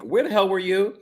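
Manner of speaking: high-pitched mock interrogation; retold angry demand. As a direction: demand answers as the imitated wife. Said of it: "she quickly switches over from".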